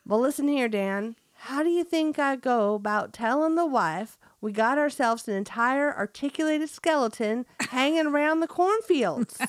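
hammed-up Southern farmer, fighting back a laugh; best country accent. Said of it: "That was my best country accent".

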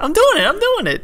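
very high, squeaky mock voice. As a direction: squeak it out like a cartoon. Mimicking a silly catchphrase.

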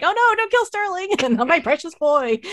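high, pleading mock whine; mock distraught plea. Fan mock-pleading with the show, high and whiny. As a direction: ham up the fan's despair, a touch of a laugh. Now fearing the character dies.

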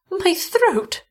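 high, faint frightened voice. A narrator's reading. Wife touching her bitten throat, frightened and high. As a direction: realise the wound with dread. Wife discovers neck wound.

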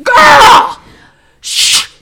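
very high, loud yell then hiss; shout. Retold or imitated. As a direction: lose patience and yell. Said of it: "And then I'm like".

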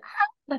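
very high short squeak; sudden high-pitched "ah"; little squeal. Retold or imitated. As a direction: imitate a tiny squeal escaping. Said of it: "this little squeal, like, this squeak".

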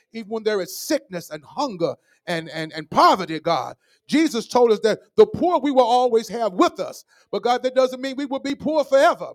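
high-pitched, impassioned church prayer; fervent prayer. Real, not acted. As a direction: plead and praise with rising fervour. Prayer rises in fervour.

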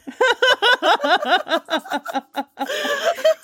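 long, high-pitched laughing fit; helpless laughter. Real, not acted. Co-host cracks up at marching ants joke, helpless high laughter. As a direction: crack up completely.

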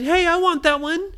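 very high, loud demand; demanding child. Acted. Ryan sees Nora's red gumball, demanding it. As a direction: pipe up loudly, wanting it now.

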